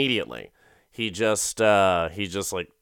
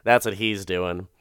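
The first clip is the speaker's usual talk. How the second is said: normal talking voice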